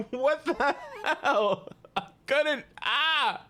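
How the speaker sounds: laughing through the words; real laughter. Real, not acted. Laughing at game freeze.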